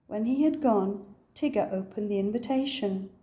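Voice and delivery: ordinary delivery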